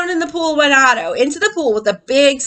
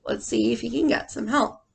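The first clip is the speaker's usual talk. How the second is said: plain reading voice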